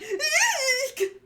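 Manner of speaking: high squealed shriek; frightened eek. Acted. Orochi squealing before Hiyori, panicked. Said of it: "we see Orochi. He's like".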